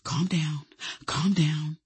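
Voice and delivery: hushed, soft plea to settle down; whispering. A narrator's reading. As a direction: soothe a friend under your breath.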